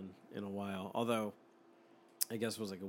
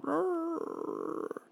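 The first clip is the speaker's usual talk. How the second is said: high drawn-out mock bark growl; imitated dog growl. Dogs barking in dream.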